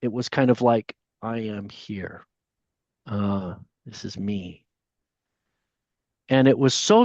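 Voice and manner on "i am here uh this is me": low, slow, hushed voice of a presence; an unseen being quietly revealing itself. Retold or imitated. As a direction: speak low and slow, like something vast announcing itself. Presence speaking to him in his mind.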